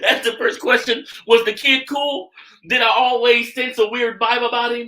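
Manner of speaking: loud, high-pitched talk through real laughter; laughing. Real, not acted. Host reacting to co-host's joke question, laughing hard. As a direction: push the words out while cracking up.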